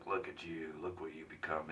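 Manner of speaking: low, soft, gritty superhero growl. Retold or imitated. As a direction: growl it like a brooding caped hero.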